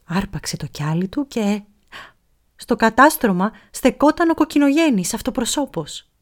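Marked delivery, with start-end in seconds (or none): none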